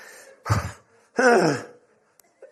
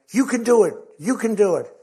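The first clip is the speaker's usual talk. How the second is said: higher, louder pep shout from the corner; coach urging; 6 semitones higher, 6 dB louder than usual. Retold or imitated. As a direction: push her back into the ring.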